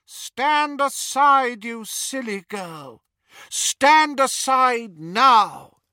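slow, loud, menacing order; cold command. Acted. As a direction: command her out of the way. Dark wizard orders mother aside.

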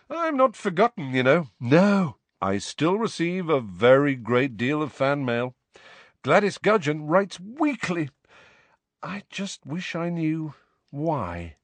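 high, preening self-important voice; vain celebrity voice. Acted. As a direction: boast like a faded star. Lockhart voice.

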